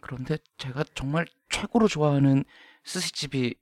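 usual speaking voice

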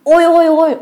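high, quoted ows; imitated pained yelp. Boys repeat the ghost's cry in surprise, high imitated yelp. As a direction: echo the yelps high, as a puzzled quote. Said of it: "असं म्हणालं".

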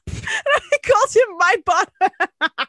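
high, slow, words half laughed; laughing. Real, not acted. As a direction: squeal it out through laughter. Cracking up at own joke.

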